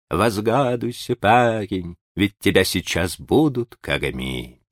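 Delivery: "Возгадуйся" with a throaty r; r swallowed into a guttural sound; burred r. A narrator's reading. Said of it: "Слегка картавя".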